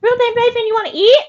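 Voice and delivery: high loud sing-song call; calling pets. Real, not acted. Calling Reuben and Raven to come eat.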